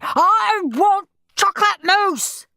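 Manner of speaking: slow, high, loud tantrum; screaming demand. A narrator's reading. Henry demanding dessert from Mum, screaming. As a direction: scream it out word by word. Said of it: "screamed Henry".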